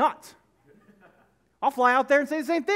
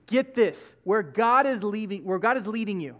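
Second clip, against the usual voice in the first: ordinary talk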